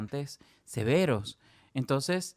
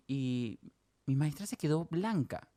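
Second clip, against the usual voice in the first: ordinary delivery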